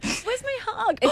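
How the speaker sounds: put-on high, soft baby voice; mocking baby talk. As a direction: whine it like a clingy baby. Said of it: "I've done it in a baby voice".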